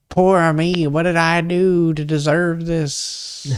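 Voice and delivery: high, slow whiny self-pitying moan; mock self-pity. Mocking whiny monsters who pity themselves. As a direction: ham up a sob story. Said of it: "crying and being like".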